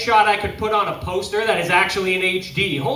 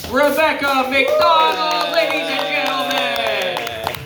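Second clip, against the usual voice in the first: big drawn-out emcee shout, higher pitched; announcer send-off; 5 semitones higher, slower than usual. Real, not acted. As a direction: stretch the name out and rally the applause. Host hails departing comic.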